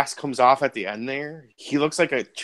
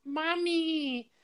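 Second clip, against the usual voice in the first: put-on very high, softer little boy's mommy; soft kid's voice; 8 dB quieter than usual. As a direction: whimper it quietly like a lost child. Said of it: "in, like, a kid's voice".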